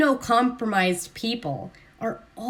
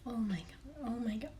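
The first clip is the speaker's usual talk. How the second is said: horrified whisper; 19 dB quieter than usual. Retold or imitated. Horrified reaction to cancer posts.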